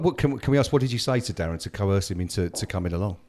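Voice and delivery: ordinary talk, nothing marked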